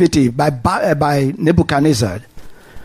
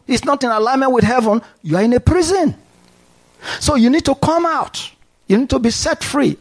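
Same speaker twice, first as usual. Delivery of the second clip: much higher, urgent preaching pitch; rising preaching fervor; 7 semitones higher, faster than usual. Acted. Preacher urging the unsaved congregation, high fervent preaching. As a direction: climb up and press the point.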